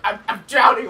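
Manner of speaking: high, loud, stammered drowning cry; acted panic. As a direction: reenact a desperate yell from the water. Reenacts yelling to friend while drowning.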